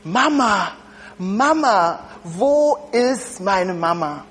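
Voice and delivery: put-on slow, plaintive child's call; child calling for mother. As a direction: call for your mama, lost and scared. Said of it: "hörte sie eine Stimme".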